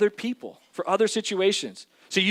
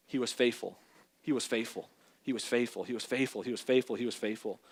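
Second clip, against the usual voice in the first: softer, repeated "he was faithful", each one quieter; soft refrain; 9 semitones lower, 10 dB quieter than usual. Real, not acted. Preacher softly recalling God's faithfulness to the congregation. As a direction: let the refrain fade to near hush.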